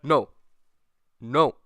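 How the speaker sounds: loud sudden no; mock scolding. Pretend classmate stopping Steve, loud. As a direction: shout it like stopping a child. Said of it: "hold his hand and be like".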